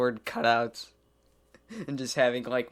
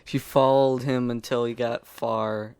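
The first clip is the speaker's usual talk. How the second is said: usual speaking voice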